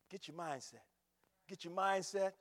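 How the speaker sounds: ordinary talk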